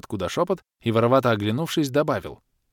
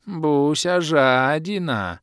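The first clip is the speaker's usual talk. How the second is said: very slow, drawn-out whine; slow drawl; 6 semitones higher, 4 dB louder, much slower than usual. A narrator's reading. Orbit drawling a childish jab at the baronesse. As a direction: stretch every word. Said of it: "протянул Орбит".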